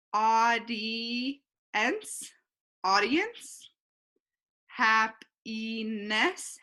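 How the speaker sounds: happiness drawn out slowly, syllable by syllable; slow read-aloud. Shown as a demonstration. Teacher reading a word slowly aloud to her students. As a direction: say happiness slowly so every sound can be heard. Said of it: "teacher reading word slowly".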